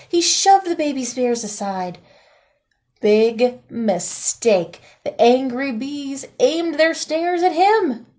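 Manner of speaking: ordinary delivery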